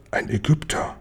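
soft, breathy whisper. Acted. Chabako spotting the Egyptian, in a whisper. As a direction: whisper it so nobody hears. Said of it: "flüstert".

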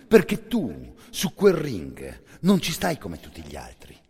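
ordinary delivery

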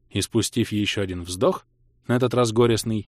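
usual speaking voice

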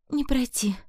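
high, despairing exhale; sorrowful sigh. A narrator's reading. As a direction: let it out high and grieved. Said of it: "горестно выдохнула амазонка".

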